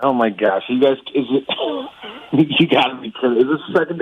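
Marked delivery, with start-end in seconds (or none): none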